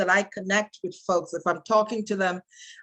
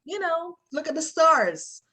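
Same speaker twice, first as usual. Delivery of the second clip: bright reassuring reply; 9 semitones higher, slower than usual. Pretend. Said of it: "I may say".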